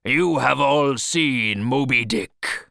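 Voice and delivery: loud, slow proclamation; exclaiming. Acted. Captain realising the crew know the whale, exclaiming. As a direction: declare it slowly and forcefully to all.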